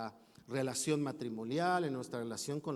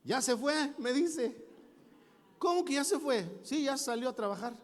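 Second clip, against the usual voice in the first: much higher voice for the wife on the phone; high voiced wife, a bit louder; voicing the wife; 10 semitones higher, 3 dB louder than usual. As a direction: play the wife answering, pitched up.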